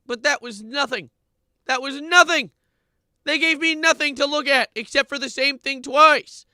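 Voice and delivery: very high, loud exasperated yell; outraged yell. Real, not acted. Streamer yelling that the song gave nothing, loud outrage. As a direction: blast it out in disbelief.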